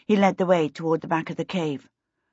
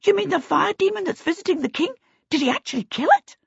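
high, fast, astonished questions; clamouring; faster than usual. A narrator's reading. Kobolds quiz Peter about the fire demon, high excited clamour. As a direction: tumble the questions out in a squeaky rush. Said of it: "they clamoured".

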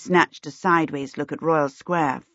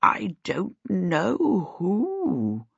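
put-on very slow, quavering old man; slow old servant; 4 dB quieter, much slower than usual. A narrator's reading. Sim slowly answering Charmaine, old and hesitant. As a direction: drag each word like a frail old man. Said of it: "Sim said slowly".

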